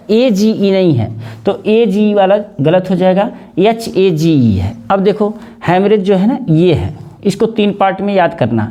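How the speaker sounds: ordinary talk, nothing marked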